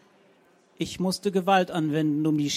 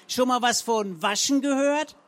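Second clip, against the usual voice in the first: higher, louder booming scold; 8 semitones higher, 5 dB louder than usual. A narrator's reading. Frau Zenker booms across the front garden, loud and high. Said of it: "Das Organ der Zenker dröhnte".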